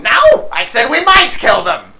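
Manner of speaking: high, loud correction; exasperated kobold boss. Acted. Bandit boss correcting his henchman, exasperated yell. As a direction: snap back high and loud.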